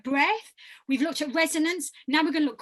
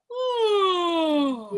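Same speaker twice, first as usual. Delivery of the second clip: high to low yawn; 4 semitones higher, 5 dB louder than usual. Shown as a demonstration. Coach shows the group, a yawn sliding high to low. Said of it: "can you go from high to low".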